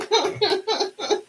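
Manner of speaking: light falling chuckle; soft laugh. Real, not acted. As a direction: laugh it off softly. Laughs after congratulating Kim.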